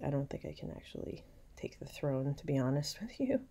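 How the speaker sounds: a sly, secret aside under the breath; whisper. Pretend. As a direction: whisper it like a scheming thought. Villain's secret thought paraphrased.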